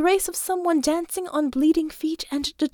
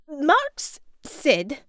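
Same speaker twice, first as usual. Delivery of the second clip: put-on Russian accent; 2 semitones higher than usual. A narrator's reading.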